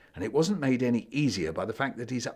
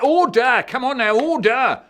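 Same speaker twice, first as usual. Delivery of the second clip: much higher, very loud call for order; the judge shouts the court to order; shouting order; 14 semitones higher, 12 dB louder than usual. Acted. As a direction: bellow it over the room. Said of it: "shouted Mister Butcher".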